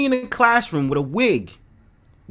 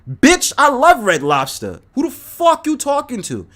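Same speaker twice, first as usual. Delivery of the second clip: heated rant; 6 semitones higher, 5 dB louder than usual. Real, not acted. Defending his love of Red Lobster to critics, heated. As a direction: snap back in defense.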